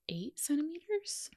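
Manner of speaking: breathy whispered aside. Real, not acted. Telling her friend how far along she was, in a whisper. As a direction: drop to a whisper for the reveal. Telling labor progress with emphasis.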